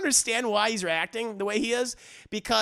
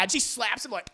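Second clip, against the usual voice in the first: usual speaking voice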